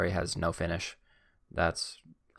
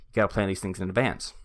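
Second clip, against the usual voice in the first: normal talking voice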